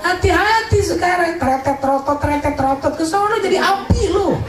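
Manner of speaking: high, laughing mock warning; hammed-up fire warning with sound words; comic warning. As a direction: tease them in a squeaky high voice.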